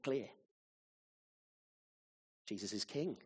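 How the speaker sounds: ordinary talk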